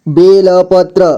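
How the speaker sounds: बेलपत्र with ल given its own vowel; syllable by syllable. Shown as a demonstration. Teacher breaking down बेलपत्र for learners, syllable by syllable. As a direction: sound out बेलपत्र piece by piece. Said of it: "जोड़ जोड़ करके".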